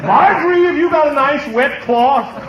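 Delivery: hammed-up squeal of dismay; flustered yelp. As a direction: cry out after sitting in the cake. Sat on the cake.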